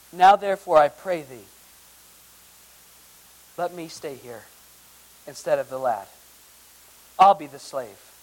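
earnest plea read from the Bible; a hint of begging; pleading scripture reading. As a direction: read Judah's offer as a humble plea.

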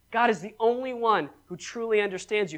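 ordinary talk, nothing marked

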